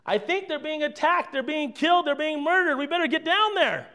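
high-pitched urgent report, acted; mock alarm. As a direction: sound the alarm as Joshua, lightly dramatized. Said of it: "what Joshua's response to Moses was".

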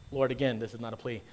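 normal talking voice

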